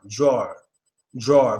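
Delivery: "drawer" with a fast W glide into the r; teacher model of "drawer" with a quick W. Shown as a demonstration. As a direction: say "drawer" low, the W slipped in quickly. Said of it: "with a fast W sound".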